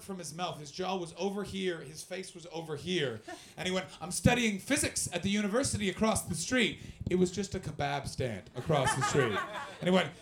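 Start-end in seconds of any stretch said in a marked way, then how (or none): 4.0-6.8 higher, louder boasting; mildly hyped-up impression; imitating coked-up guy. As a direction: play a wired guy making a big claim. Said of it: "He was on cocaine in the afternoon".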